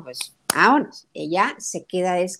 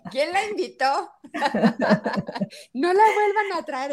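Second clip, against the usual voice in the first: higher, laughing through the words; mock hosts, laughing; 6 semitones higher than usual. Mother mimicking hosts complaining about her daughter, laughing. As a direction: laugh while playing annoyed hosts. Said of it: "van a decir".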